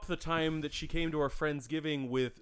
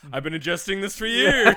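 loud, higher mock boast; Princess Bride parody; 5 semitones higher, 7 dB louder than usual. Joking about the Windex bowl, film-hero parody. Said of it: "It was like the Princess Bride".

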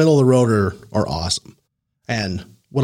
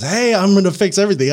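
loud, higher-pitched mock announcement; over-eager intruder; 8 semitones higher, 4 dB louder than usual. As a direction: burst in like an overzealous helper. Acting out a wild intruder.

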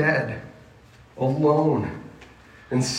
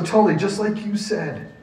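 normal talking voice

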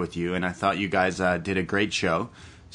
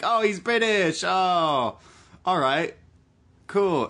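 higher-pitched mock delight; impressed locals; 9 semitones higher, slower than usual. Imitating Toronto landlords reacting to a British flat-hunter, impressed. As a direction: play locals charmed by a Brit. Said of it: "People here are like".

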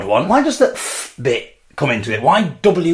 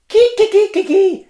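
Ki, ki, ki squawked much higher and louder like a cockerel; rooster call, high and loud; 3 dB louder than usual. Shown as a demonstration. Showing viewers the Greek cockerel, high loud crow. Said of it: "the sound that a cockerel makes".

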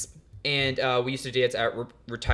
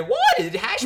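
high, slow line with a voice crack; 6 dB louder than usual. Shown as a demonstration. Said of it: "I had a voice crack".

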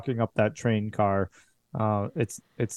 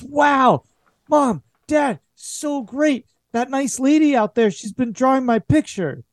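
put-on high, bright-eyed child voice, much higher; a little boy gushing to mom and dad; excited kid Amos; 12 semitones higher, 3 dB louder than usual. GM voicing the boy Amos to his parents, high excited child voice. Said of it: "He says".

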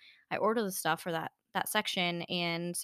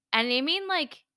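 usual speaking voice

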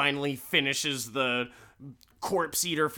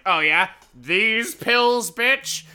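higher, louder slow taunt; gotcha taunt; 8 semitones higher, 8 dB louder, slower than usual. Retold or imitated. Wife waving the pills at her lying husband, loud slow taunt. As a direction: rub his face in it.

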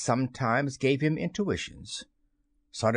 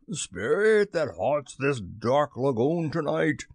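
slow, dramatic summons to a spirit; projected captain's call, uneasy; raised, quivering voice; 3 semitones higher, slower than usual. A narrator's reading. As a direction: call out slowly across the water. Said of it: "Hook raised his voice, but there was a quiver in it".

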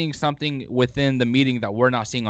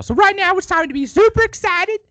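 way higher, louder hype-man voice; hyped-up weird voice; 4 dB louder than usual. Pretend. Host waking up his co-host, over-the-top hype voice. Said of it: "I'm done with that accent".

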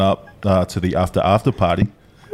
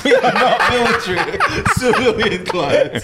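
laughing; 12 semitones higher than usual. Real, not acted. Laughing at the question.

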